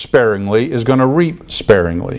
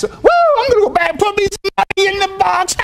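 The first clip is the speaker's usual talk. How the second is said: much higher, fast hammed-up whoop; mock giddy whoop; faster than usual. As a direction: ham up a giddy dancer. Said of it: "I don't want to see anybody dance".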